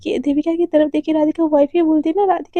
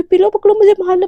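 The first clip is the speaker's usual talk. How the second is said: higher, louder frantic plea; desperate pleading; 5 semitones higher, 6 dB louder, faster than usual. Acted. Radhika begging the sobbing Devika to hit her instead. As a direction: beg her to hit you rather than cry. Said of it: "फूट फूट के रोने लगती है".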